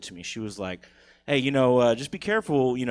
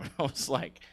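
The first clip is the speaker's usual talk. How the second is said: usual speaking voice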